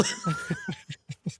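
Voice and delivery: sudden very high squealing laugh; burst of laughter. Real, not acted. Laughs at the Walrus name.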